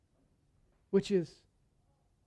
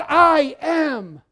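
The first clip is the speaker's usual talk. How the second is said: loud, raised preaching voice; emphatic proclamation; 5 semitones higher, 7 dB louder than usual. Real, not acted. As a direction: ring it out as the climax. Preacher proclaims the climactic quote.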